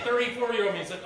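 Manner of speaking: ordinary talk, nothing marked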